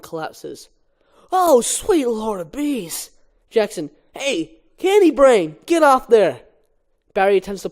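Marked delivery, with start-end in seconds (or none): 1.3-3.1 slow, higher, drawn-out moan of wonder; awed groan. Acted. Barry collapses on the ball. 4.1-6.4 higher and louder, slow teasing yell; warning shout. Acted. Jackson warns Barry.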